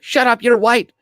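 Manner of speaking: high, loud imitation of a furious woman; mock angry woman. Mimicking the angry woman from the clip, mock furious yell. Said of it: "she was angry as shit".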